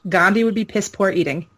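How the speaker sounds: ordinary talk, nothing marked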